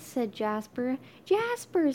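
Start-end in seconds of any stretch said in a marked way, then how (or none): 1.3-1.9 high startled cry of the name. Acted. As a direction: gasp out the name in shock. Startled kids see Jasper.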